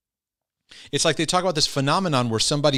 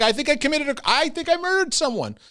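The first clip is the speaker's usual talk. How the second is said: much higher, frantic anxious voice; panicked confession; 14 semitones higher than usual. Acted. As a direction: blurt it out in panic. Anxious OCD sufferer panicking.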